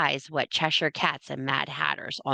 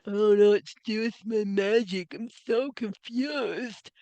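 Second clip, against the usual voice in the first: mock confused whine; 4 semitones higher, slower than usual. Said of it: "You're going".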